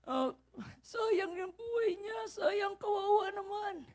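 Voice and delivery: mock tearful voice, climbing high and soft; imitated weeping. Pedicab driver mourning a young nephew, imitated sobbing lament. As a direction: imitate someone crying through the words. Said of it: "yung driver iyak na iyak".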